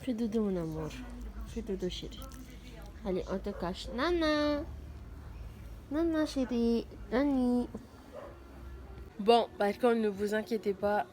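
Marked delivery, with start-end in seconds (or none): 0.0-3.9 soft, fading lullaby murmur; whispering over a crib. Acted. Lulling dog to sleep. 3.9-7.7 whispering over a crib. Acted. Calls dog tenderly.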